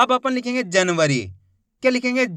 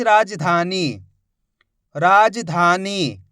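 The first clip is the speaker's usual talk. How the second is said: राजधानी said slowly, syllable by syllable; slow dictation; 3 semitones lower, much slower than usual. Shown as a demonstration. Teacher dictating a word to students, slowly. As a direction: dictate राजधानी so they can write it. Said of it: "शब्द को अच्छी तरीके से सुनो".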